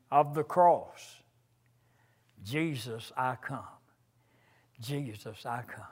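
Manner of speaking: hymn lines intoned, swelling on each opening line; preacher reciting a hymn, rising then hushing; hymn recited. Acted. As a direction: chant the hymn words, falling to a hush on the refrain.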